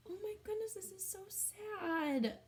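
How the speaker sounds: mock sad gasp. Reacting to the rabbit's tear.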